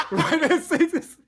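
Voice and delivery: high, amused voice breaking into laughter; laughing. Real, not acted. Riffing to co-hosts on a safe space, laughing. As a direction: laugh through the words. Laughing through a mock sales pitch.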